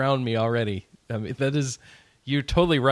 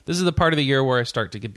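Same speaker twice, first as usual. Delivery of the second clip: usual speaking voice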